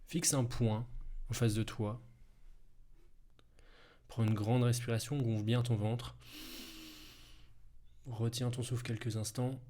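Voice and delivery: calm and unhurried, guide each breath; guided hypnosis. Acted. Leads a hypnosis breathing exercise.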